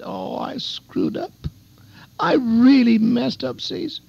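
acted drunken friend's wail, drawn out; hammed-up remorse, breaking from husky into high pitch; tearful confession. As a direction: imitate a crying man owning up, slowly.

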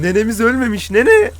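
high, loud joking call to grandma; half-joking shout to the game grandma; mock excited call. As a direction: sing out nene like a happy grandson. Calling to the game's grandma.

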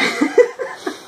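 short breathy burst of laughter; a quick laugh at his own surprise; surprised laugh. Real, not acted. Vlogger laughs, surprised by his childhood writing, breathy laugh.

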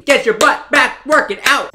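loud, high drill shout. Real, not acted. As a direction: yell them out of bed. Yelling sims awake at midnight.

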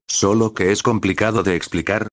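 usual speaking voice

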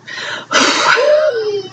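tearful sigh catching in the throat; a real sigh while fighting tears; shaky high sigh. Real, not acted. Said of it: "I just had a little crying spell".

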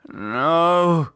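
moaning no. Acted. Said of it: "he moaned".

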